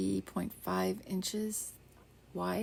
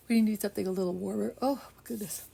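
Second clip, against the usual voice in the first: stretched by a real yawn; words pulled out of shape by a yawn; yawning through the words; 3 semitones higher, 4 dB louder, faster than usual. Real, not acted. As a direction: say it mid-yawn. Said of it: "pardon my yawn".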